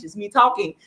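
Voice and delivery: usual speaking voice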